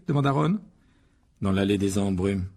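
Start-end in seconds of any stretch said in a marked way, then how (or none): 1.4-2.5 low-key, somber answer; gloomy. Acted. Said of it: "répondit Harry d'un air sombre". (réponse un peu sombre)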